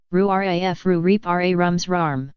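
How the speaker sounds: mumbled slowly through a mouthful; mouth full. Acted. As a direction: talk around a mouthful of worms. Boy's mouth full of worms.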